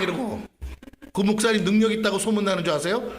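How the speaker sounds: ordinary talk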